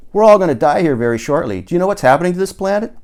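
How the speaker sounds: fast urgent doom warning; Paul, alarmed. A narrator's reading.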